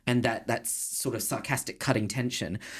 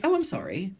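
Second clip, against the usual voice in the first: dry British sarcasm; 8 semitones higher than usual. Retold or imitated. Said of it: "very, like, British".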